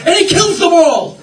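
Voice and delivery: high, loud preacher's cry; soaring full-voice pulpit yell; climactic shout. Real, not acted. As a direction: belt out the climax of the tale.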